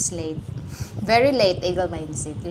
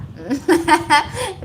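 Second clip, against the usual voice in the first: a burst of laughing, higher and louder; loud laughter; 9 semitones higher, 6 dB louder than usual. Real, not acted. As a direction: crack up at your own slip. Laughs at the Rita/Riza mix-up.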